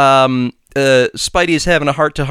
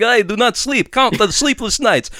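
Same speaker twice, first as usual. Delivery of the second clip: Count impression; 6 semitones higher than usual. Podcaster retelling Morbius, voicing him as the Count. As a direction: count the sleepless nights like the Count with a laugh. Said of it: "a really good Count impression".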